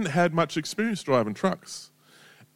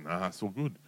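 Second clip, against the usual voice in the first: unbothered calm; 6 semitones lower, 10 dB quieter than usual. Retold or imitated. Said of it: "And he's like".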